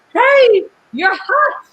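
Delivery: loud, high-pitched "hey"; mock catcaller. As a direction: call out an exaggerated pickup line. Imitating men who grab at her.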